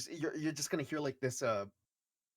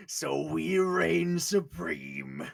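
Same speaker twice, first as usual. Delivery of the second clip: very slow, drawn-out pride; smug slow boast; 4 dB louder, much slower than usual. Acted. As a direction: savor every word of the boast. GM voicing smug character.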